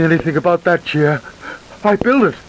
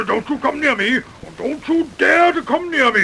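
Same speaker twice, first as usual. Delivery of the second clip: frightened warning; 8 semitones higher than usual. Acted. Cornered suspect warning off.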